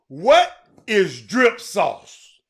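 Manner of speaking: slow, puzzled what is drip sauce; slow drawn-out question. Retold or imitated. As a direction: stretch each word out slowly. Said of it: "I heard some people say".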